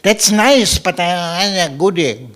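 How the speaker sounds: lightly voiced child, a bit high; a hint of a cheeky kid; child's voice. Child answering father's lecture, lightly voiced cheeky kid. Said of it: "And children go".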